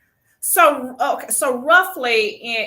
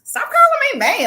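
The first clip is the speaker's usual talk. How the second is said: higher, louder, slower playful complaint; mock protest; 13 semitones higher, 6 dB louder than usual. Real, not acted. Host teasing guest about calling her ma'am. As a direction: pretend outrage at being called ma'am.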